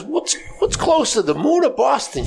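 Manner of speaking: high, slow voice for the friend; friend in the joke. Retold or imitated. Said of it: "She says to the blonde, she goes".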